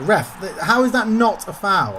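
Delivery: loud, drawn-out complaint to the ref; indignant protest. Real, not acted. Gamer protesting a missed foul to the referee, indignant.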